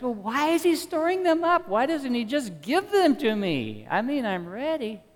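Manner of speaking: high, eager asking voice; impatient listener. Retold or imitated. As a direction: voice an impatient believer. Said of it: "So you ask".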